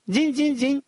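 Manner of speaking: high-pitched ringing imitation of shards; high, singsong tinkle; tender sung tinkling. A narrator's reading. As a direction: chime like bits of crystal. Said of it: "нежно пропели его осколки".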